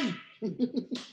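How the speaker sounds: short chuckle; amused laugh. Real, not acted. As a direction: chuckle at the dropped call. Laughs at the dropped call. (maikling tawa)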